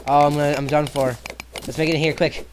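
being chased. Real, not acted. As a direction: cry out in frantic panic. Drowning panic in game.

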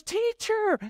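very high-pitched cry of recognition; acted high excited call; acted exclamation. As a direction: squeal TEACHER as if suddenly recognizing him. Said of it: "She goes".

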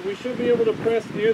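muffled behind face mask; speaking through filter mask; through a respirator mask. Real, not acted. Seen 3: Half-face respirator with filters worn over mouth and nose throughout.

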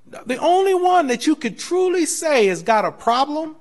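full-force preacher; preaching at full force. Real, not acted. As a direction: drive the point home to the church. Preaching builds to a peak.

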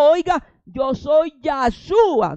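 slow, loud, booming divine voice; voice of the Lord. Acted. The Lord answering Saul on the road, slow loud booming voice. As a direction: boom it down from heaven, very slow. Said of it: "y le dijo".

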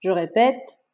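slow teacher dictating syllables, evenly spaced with pauses; measured classroom dictation voice. Shown as a demonstration. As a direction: announce each item slowly, then give the syllable clearly on its own.

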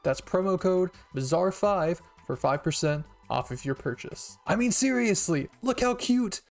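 high, loud, upbeat promo read. Acted. Reading a sponsor ad for anime merch, loud promo voice. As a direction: pitch the merch loudly and brightly.

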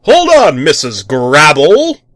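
loud, high heroic roar. Acted. The tick roaring as he charges after the neighbor. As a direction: bellow it as he charges. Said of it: "the tick roared".

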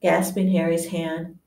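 ordinary delivery, nothing marked

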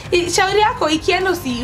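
ordinary talk, nothing marked

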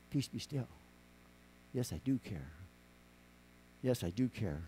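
very soft, dropping low, with long pauses; calming reassurance. Acted. Jesus answering his frightened disciples, quiet and reassuring. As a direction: hush the storm gently and unhurriedly.

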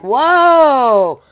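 loud, high "whoa, put the brakes on"; mock alarmed colonist crying whoa; colonists protesting. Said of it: "the colonists were like".

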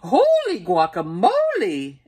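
loud, startled cry; exclaiming. Acted. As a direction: burst out in surprise. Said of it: "exclaimed Skippy John Jones".

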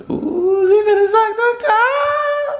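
high, slow squeal; squealing tease. Real, not acted. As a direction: squeal it out teasingly. Teasing, squealing.

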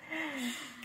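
a soft, high giggle; high, breathy laugh. Real, not acted. Laughing while telling about the teacher's surprised reaction. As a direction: laugh at how she reacted.

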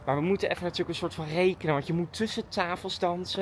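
usual speaking voice

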